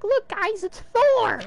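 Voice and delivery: very high-pitched squealed call; excited onlooker. Acted. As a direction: shriek it like a thrilled fan, hammed up. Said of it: "She's like".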